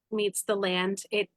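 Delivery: usual speaking voice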